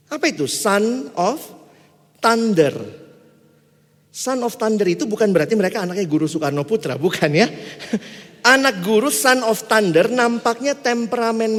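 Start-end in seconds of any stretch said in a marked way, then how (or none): none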